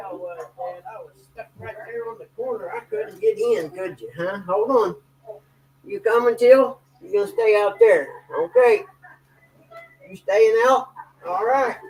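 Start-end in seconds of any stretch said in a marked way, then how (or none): 4.5-11.8 calling across the yard. Real, not acted. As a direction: raise your voice to someone outside. Calling out to Till outside.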